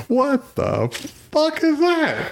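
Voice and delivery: very high, drawn-out incredulous squeal; mock bafflement. Onlooker sees a baby vigilante crash in, high baffled disbelief. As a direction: exaggerate the disbelief, high and stretched. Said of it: "but more of like".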